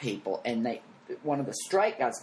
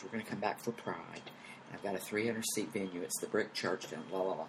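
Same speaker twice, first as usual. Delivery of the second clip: low, hushed confidential murmur; retold whisper; 5 semitones lower, 10 dB quieter than usual. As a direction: pass it on quietly, like a secret backstage. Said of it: "He whispered to me".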